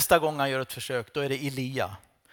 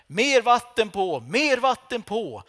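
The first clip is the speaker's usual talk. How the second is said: higher, louder, slow repeated cry; loud slow call; 7 semitones higher, 6 dB louder, slower than usual. Acted. Elijah calling for more water over the altar, slow loud cry. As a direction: chant the call, each word pressed.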